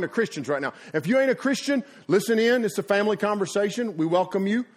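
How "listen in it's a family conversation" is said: ordinary talk, nothing marked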